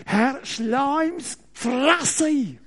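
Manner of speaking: hammed-up furious psalm prayer; mock angry prayer. David betet wütend im Psalm. (langsames, gespielt wütendes Gebet)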